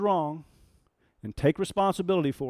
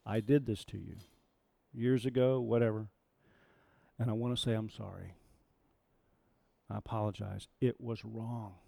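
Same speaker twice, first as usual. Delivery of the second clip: soft, quiet, simple apology; sincere apology; 5 semitones lower, 8 dB quieter than usual. Shown as a demonstration. Teacher showing how to apologise to someone you wronged, soft and sincere. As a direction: say sorry gently with no excuses. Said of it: "You just tell them what the offense was".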